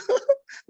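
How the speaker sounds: high-pitched burst of laughter. Real, not acted. Speaker amused by the partner's reaction, laughing. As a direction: break into a quick high laugh.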